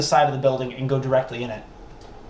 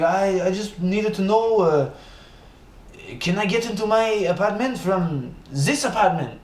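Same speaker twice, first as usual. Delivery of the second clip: put-on thick French accent; 4 semitones higher than usual. Locked-out French neighbor asking the way in, thick French accent. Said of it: "he speaks with a very thick French accent".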